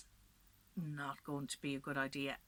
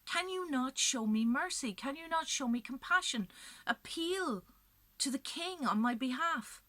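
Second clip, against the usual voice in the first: high, loud desperate plea to the crowd; pleading appeal; 9 semitones higher, 6 dB louder than usual. A narrator's reading. Macha begs the crowd for mercy, loud, high and desperate. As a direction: beg the crowd, voice climbing high. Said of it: "she looks at them and she says".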